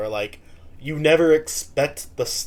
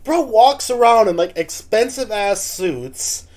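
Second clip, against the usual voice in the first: higher and louder, fired-up protest; incredulous rant; 7 semitones higher, 6 dB louder than usual. Real, not acted. Reactor calling out the guest's posh denial, loud and excited. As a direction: call out the nonsense, worked up.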